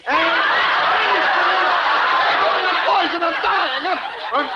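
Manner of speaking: loud, high, drawn-out horrified cry; excited panic. Acted. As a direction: wail as if poisoned, comically hammed-up. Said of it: "Don't get excited".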